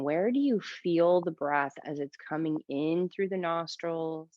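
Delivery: calm yoga teacher voice with long pauses; guided meditation. Shown as a demonstration. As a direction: ease them into stillness, leaving space between phrases. Leads breathing meditation in yoga class.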